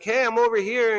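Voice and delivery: lightly voiced shout to passersby; calling out. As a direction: wave people over with your voice. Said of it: "because I'm like".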